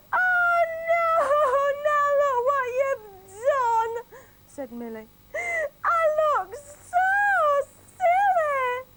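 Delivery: very high mock wail; hammed-up distressed cry; wailing wife. Wife Millie wailing to her husband with a sausage nose.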